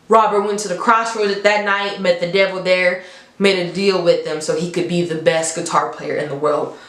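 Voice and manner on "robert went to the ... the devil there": ordinary talk, nothing marked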